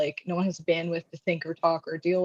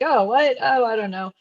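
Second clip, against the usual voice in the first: higher, louder startled reply; mock flustered surprise, pitched up; caught off guard; 5 semitones higher, 3 dB louder than usual. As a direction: imitate someone thrown by the question. Acting out caught-off-guard people's answer.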